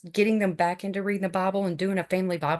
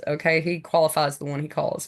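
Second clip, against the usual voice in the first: usual speaking voice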